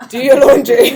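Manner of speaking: sudden loud mock-stern order; firm command. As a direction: bark it out like a strict mum, then laugh.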